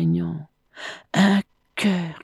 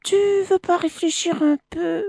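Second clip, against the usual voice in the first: small, high, quick plea; timid; 12 semitones higher, faster than usual. A narrator's reading. Crocodile begs the monkey to reconsider, timid. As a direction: ask it timidly in a high, hurried voice. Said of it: "demanda timidement".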